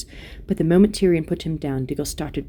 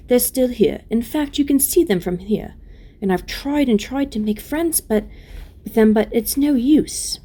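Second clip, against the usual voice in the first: put-on higher, louder voice of the young queen; tender-hearted Lucy, pleading; 6 semitones higher, 4 dB louder than usual. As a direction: plead earnestly on the dwarfs' behalf.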